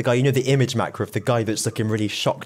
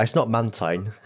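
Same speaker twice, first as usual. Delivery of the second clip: normal talking voice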